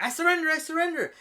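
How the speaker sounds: high, loud, fast mock pleading; pleading surrender. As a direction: beg quickly in a high loud voice. Mob boss caught by police.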